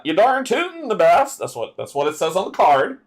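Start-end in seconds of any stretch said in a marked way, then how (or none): 0.0-1.4 high, lively country twang; country accent. Acted. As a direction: put on a hammed-up country accent. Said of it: "my country accent".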